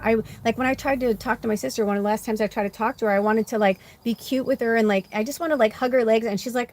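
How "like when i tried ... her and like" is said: usual speaking voice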